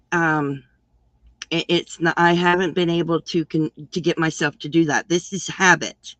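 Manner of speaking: low, careful video voice; developed speaking voice. Real, not acted. Explaining a habit after being teased, careful speaking voice. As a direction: hold the country accent back, pitch kept low. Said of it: "so I developed a speaking voice".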